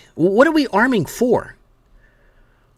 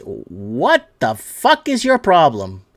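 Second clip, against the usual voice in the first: disgusted; 5 semitones lower, much slower than usual. Real, not acted. Ranting at the headline.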